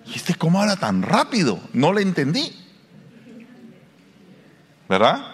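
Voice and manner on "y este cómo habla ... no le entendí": higher, mock-astonished reaction; lightly voiced baffled listener; amazed listener. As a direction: react like someone lost in a fast rap. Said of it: "dice uno".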